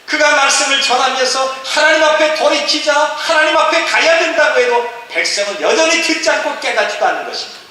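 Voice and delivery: high, loud, sustained proclamation; full-force preaching. Acted. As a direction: preach at the top of the register without letting up. (높고 크게 부르짖는 설교)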